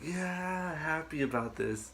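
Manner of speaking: stretched-out retold cheer; imitating excited mother. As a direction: play mom's delight, easing down. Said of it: "she was excited".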